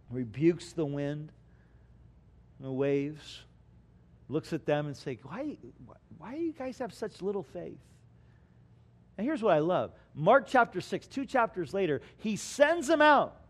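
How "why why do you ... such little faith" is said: much softer, quick tender chiding; gentle rebuke. Acted. Jesus gently chiding his disciples after the storm. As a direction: chide them softly.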